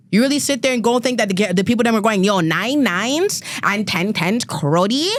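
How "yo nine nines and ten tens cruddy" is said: slow, drawn-out imitation of a slang accent; exaggerated youth slang accent, slowed down; mock Toronto slang accent. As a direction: lightly mimic the young slang accent, dragging the words. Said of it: "nobody's talking like that".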